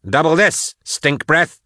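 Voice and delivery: high-pitched, angry retort; jeering, heated comeback; defiant insult. Acted. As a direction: throw the insult back hard. Patience hurls insult back.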